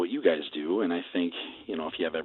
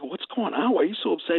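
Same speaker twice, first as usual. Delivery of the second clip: much higher, urgent questioning; retold concern; 11 semitones higher than usual. Calling an upset radio colleague, high-pitched retold concern. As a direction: imitate yourself anxiously checking on a friend. Retelling a worried phone call.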